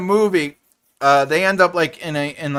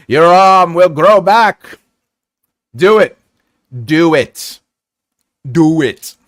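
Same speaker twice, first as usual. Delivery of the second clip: loud, slow insistent "Do it"; urging movie quote; 10 dB louder, slower than usual. Pretend. Streamer quoting a movie to chat, loud urging. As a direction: push them on like a movie hero.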